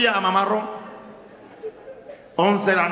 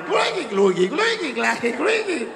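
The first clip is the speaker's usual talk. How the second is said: rising, squeaky clunking car sound; squeaking car noise; 8 semitones higher, slower than usual. Retold or imitated. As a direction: imitate a rickety car squeaking, climbing higher. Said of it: "empieza a salir ese carro chillando".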